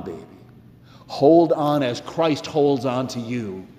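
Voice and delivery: ordinary talk, nothing marked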